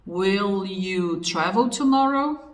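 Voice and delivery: slow, drawn-out English question with rising end; slow example sentence. Shown as a demonstration. Teacher repeating a second English example, slowly. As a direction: stretch it out, lift the last word. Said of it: "eu poderia colocar".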